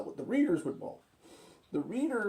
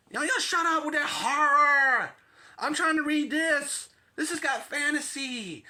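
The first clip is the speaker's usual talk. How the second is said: high, loud, exasperated yelling; annoyed yelling roommate; 8 semitones higher, 5 dB louder than usual. Acted. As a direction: yell through the wall to shut them up. Third voice yells from next room.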